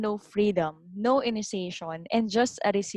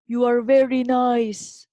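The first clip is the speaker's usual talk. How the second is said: loud, slow call; father's shout; 3 semitones higher, 6 dB louder, slower than usual. A narrator's reading. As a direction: call it out slowly to the mountain. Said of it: "The father shouted".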